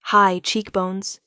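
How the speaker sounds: ordinary delivery, nothing marked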